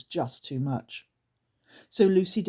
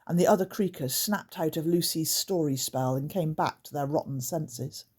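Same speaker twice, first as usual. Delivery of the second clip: usual speaking voice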